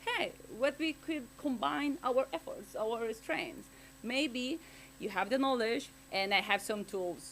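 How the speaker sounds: normal talking voice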